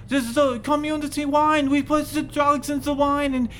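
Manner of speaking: high, loud frantic confession; panicked chicken. Acted. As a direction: babble it out as a terrified chicken. Chicken confesses to dosing priest.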